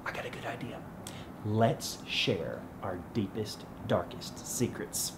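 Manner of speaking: hushed, conspiratorial. Acted. Proposing secrets in a shared cell.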